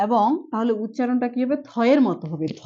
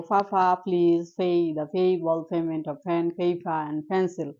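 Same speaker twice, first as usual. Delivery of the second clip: slow motion practice; 4 semitones lower, much slower than usual. Shown as a demonstration. Teacher drilling the class in slow motion. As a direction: stretch every word in slow motion. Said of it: "প্রথমে আমরা একটু slow মোশনে practice করব".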